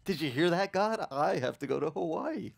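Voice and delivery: incredulous, laughing through the words; half-laughing at the absurd order; asking God with a laugh; laughing disbelief. Retold or imitated. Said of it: "I remember laughing inside".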